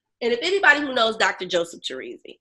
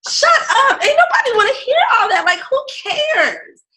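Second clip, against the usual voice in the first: much higher, laughing, brushing him off; laughing dismissal; 14 semitones higher, 4 dB louder than usual. Retold or imitated. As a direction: laugh him off mid-word.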